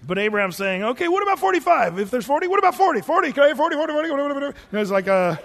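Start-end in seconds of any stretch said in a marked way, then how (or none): 0.8-4.4 much higher and louder, fast bidding; auctioneer haggling. Retold or imitated. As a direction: rattle off the numbers like an auctioneer. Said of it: "sort of the auctioning off this thing".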